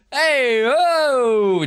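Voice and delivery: high, loud delighted hey; excited greeting. Real, not acted. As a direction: call out in delight. Greeting a viewer.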